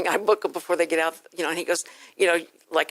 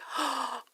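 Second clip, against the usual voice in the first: sharp, quick intake of breath; excited gasp; 7 semitones higher, 11 dB quieter than usual. Retold or imitated. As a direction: gasp as if thrilled by the news. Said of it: "and I was like".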